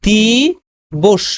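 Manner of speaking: দিবস stretched out slowly, ending in a clear palatal "sh"; slow pronunciation demo. Shown as a demonstration. Teacher slowly shows students how to say দিবস, final স as "sh". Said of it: "যখন স আসবে তখন তালব্য স উচ্চারণ করতে হবে".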